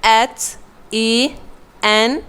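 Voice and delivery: spelling out एच ई very slowly, letter by letter; name each letter slowly and clearly. Shown as a demonstration. Teacher spelling the word for students, very slowly. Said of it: "ये है".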